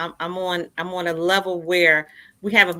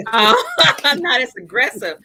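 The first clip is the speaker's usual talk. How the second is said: laughing through the words, higher and louder; 6 semitones higher, 6 dB louder than usual. Real, not acted. Guest laughing at her own claim, laughing aloud. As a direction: laugh it out at yourself.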